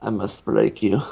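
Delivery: a light low Russian threat; a half-hearted copy of the Drago line; mock Ivan Drago. Co-host echoing the Drago quote, lightly Russian. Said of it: "Ivan Drago".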